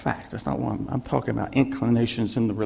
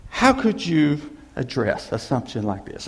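ordinary delivery

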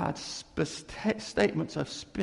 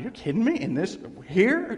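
higher, louder, disbelieving; surprised disbelief; 5 semitones higher, 4 dB louder than usual. Retold or imitated. Preacher retelling his shocked question to the janitor, surprised.

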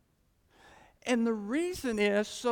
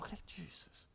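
whispered, very soft surrender; hushed assent; 4 semitones lower, 17 dB quieter than usual. Retold or imitated. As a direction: answer under the breath, submissively. Acting out his humble response.

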